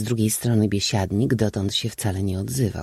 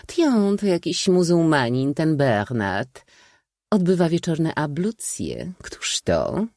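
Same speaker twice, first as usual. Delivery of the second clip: high chattering voice, dropping to a whisper; gossiping aunt; 7 semitones higher than usual. A narrator's reading. Aunt gossiping about the valet, high chatter. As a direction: prattle then lean in.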